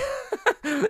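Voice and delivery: high-pitched giggle; laughing. Real, not acted. Podcaster laughing at his boring life, high giggle. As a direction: break into a high laugh at yourself.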